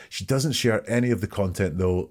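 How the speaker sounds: ordinary talk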